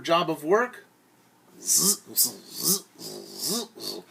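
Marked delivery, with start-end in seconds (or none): none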